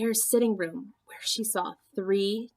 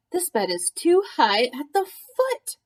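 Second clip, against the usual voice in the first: higher, louder grumble; a fussy little complaint; frowning complaint; 6 semitones higher, 4 dB louder than usual. Acted. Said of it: "she frowned".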